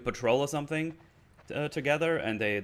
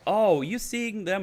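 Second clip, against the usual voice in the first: high, louder, slow teasing jab; teasing Dina; 11 semitones higher, 6 dB louder, slower than usual. Retold or imitated. Dina makes fun of Joel for seeing a therapist, slow and teasing. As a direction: stretch it out to needle him. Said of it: "she kinda makes fun of him".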